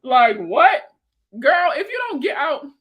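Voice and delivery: shouted mock disbelief. Real, not acted. Mock outrage at a chat member.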